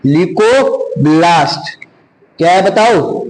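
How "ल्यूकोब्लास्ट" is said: slow stretched "leuco-", held apart from the rest; teacher isolating "leuco-" for the class; show the word. Shown as a demonstration. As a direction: break off "leuco-" slowly so students hear the part. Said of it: "ये देखो".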